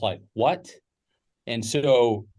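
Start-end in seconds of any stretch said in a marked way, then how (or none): 0.3-0.7 stunned what. Retold or imitated. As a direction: pop up in shock. Stunned at father's generous offer.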